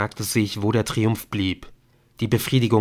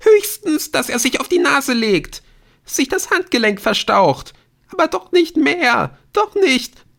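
high, loud desperate wail; screaming; 7 dB louder than usual. A narrator's reading. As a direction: cry out frantic excuses. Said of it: "Jetzt schrie sie".

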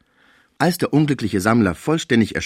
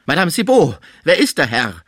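loud, alarmed question, starting high; shuddering call; 7 semitones higher, 5 dB louder than usual. A narrator's reading. Sick man calling his caretaker about a stranger, alarmed call. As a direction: call out as a shudder seizes you. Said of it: "rief er, ... von einem Schauer ergriffen".

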